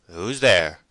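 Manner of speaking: loud male challenge from inside; masculine voice, unsurprised. A narrator's reading. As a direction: call out firmly, without surprise. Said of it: "The tones were masculine, and not those of surprise".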